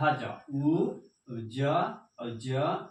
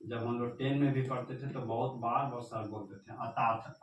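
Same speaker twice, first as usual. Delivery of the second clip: ordinary delivery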